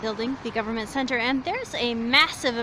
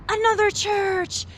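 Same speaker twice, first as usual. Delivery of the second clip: excited; 9 semitones higher, 7 dB louder, slower than usual. Real, not acted. Spots a church while walking.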